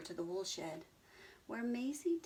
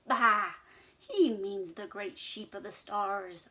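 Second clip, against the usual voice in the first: loud bleating protest; arguing bleat; 9 dB louder than usual. Acted. Sheep arguing with Abigail, loud bleat. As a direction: bleat back loudly. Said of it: "argued the sheep".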